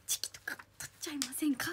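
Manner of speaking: soft, secretive whispered invitation; flirty whisper. Real, not acted. Inviting a fan to take photos together, whispered.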